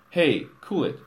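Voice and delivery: a loud, flustered warning; stammered warning. A narrator's reading. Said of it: "he stammered".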